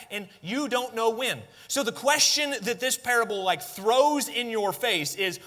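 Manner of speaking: high, loud urgent preaching; preaching at full force. Acted. Preacher urging listeners to be ready for the groom, full force. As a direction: drive the warning home to the congregation.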